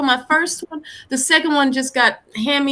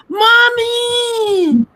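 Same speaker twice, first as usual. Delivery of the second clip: put-on high, loud child's call; kid yelling mommy; 10 semitones higher, 4 dB louder than usual. Child calling for mom through the bathroom door, loud and high.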